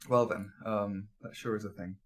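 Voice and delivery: nervous murmur. Real, not acted. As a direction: go quiet with dread. Player quietly taken aback.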